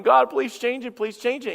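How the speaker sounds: high, pleading cry to God; a frustrated believer begging; desperate plea. Retold or imitated. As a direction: beg God to change it.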